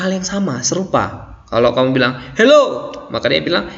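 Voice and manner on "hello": lively demonstration hello; spirited hello. Shown as a demonstration. Teacher modelling what you say when greeting someone, spirited. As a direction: greet with energy and clear intonation. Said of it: "ada semangat ketika kita berbicara".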